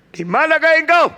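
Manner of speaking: high, loud calling out; loud shout. Shown as a demonstration. Preacher tries the line as a high shout. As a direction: shout the question across a distance.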